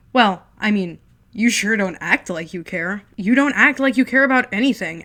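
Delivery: loud sarcastic yelling; angry shouting. Acted. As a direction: hurl the words at him. Heated shouting match.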